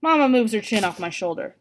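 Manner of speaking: usual speaking voice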